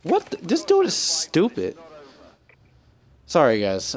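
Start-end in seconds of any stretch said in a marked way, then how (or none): 0.0-1.8 frustrated. Real, not acted. Gamer fuming at another player, high and exasperated.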